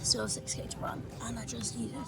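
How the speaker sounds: drained, breathy half-whisper; exhausted whisper. Real, not acted. Runner at the water stop, worn-out whisper.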